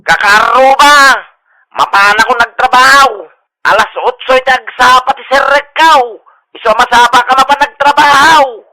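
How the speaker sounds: very loud megaphone proclamation; blaring megaphone. Acted. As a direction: blast it over the whole street. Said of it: "Sapay nagpigsa mo ti megaphone mo". (napigsa unay nga pukkaw iti megaphone)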